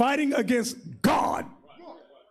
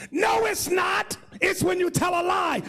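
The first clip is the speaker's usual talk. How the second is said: high emphatic retort; mock indignant correction; sister objecting; 7 semitones higher than usual. Sister's objection in retold exchange.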